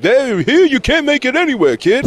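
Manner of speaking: high, slow New York Italian guy; New York Italian accent. Acted. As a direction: do a hammed-up New York wiseguy. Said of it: "That was a good impersonation".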